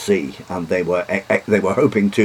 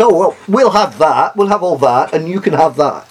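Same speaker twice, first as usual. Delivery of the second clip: higher, louder mock-smug dividing of the spoils; cheeky British; 7 semitones higher, 4 dB louder than usual. Lecturer voicing British diplomats, cheeky and smug. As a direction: carve up the map with a cheeky grin. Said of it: "the British were a bit cheeky".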